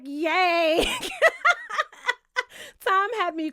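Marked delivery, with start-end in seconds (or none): 0.0-0.8 louder, mock-whiny fake cheer; a sarcastic, half-crying yay; imitating a miserable, sarcastic yay. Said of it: "he was like". 0.9-2.1 cackling laugh, high and loud; real high cackle. Real, not acted. Laughing at Tom's reaction.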